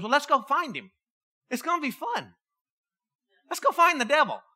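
high, lilting invitation to mischief; gleeful, high-voiced suggestion; playful eagerness. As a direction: pitch it up like proposing an adventure. Spirit eagerly proposing devil hunt.

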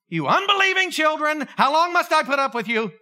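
put-on a fed-up parent telling the kids off; scolding parent. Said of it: "That's what you say to your kids".

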